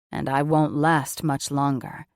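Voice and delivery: groaning. A narrator's reading. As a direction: hold back a groan as a lover. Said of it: "he groaned".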